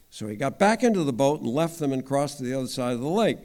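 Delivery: ordinary talk, nothing marked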